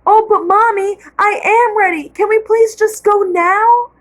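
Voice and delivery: put-on a small girl begging to leave now; excited kid pleading with mom; eager little girl. A narrator's reading. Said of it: "Rose asked".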